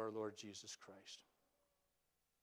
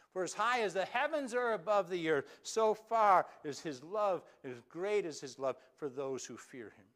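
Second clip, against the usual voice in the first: fervent preaching; 6 semitones higher, 12 dB louder than usual. Acted. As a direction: proclaim it to the rafters. Quoting the psalm with fervor.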